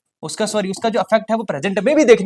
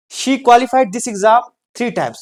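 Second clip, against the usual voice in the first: slow dictation; 6 semitones higher, 5 dB louder, much slower than usual. Shown as a demonstration. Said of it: "teacher dictates example".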